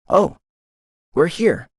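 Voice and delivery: realizing they've arrived, exclaiming. A narrator's reading. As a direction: blurt it out as you notice. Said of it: "I exclaimed".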